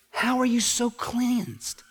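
high, drawn-out astonished question; acted amazement. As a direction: ask it slowly in high-pitched wonder. Amazed neighbours question the leper.

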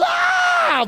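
very high, long comic scream; imitated panicked scream. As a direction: scream along like a panicked husband. Imitates himself screaming beside wife.

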